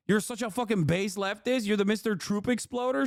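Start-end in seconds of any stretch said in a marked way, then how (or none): none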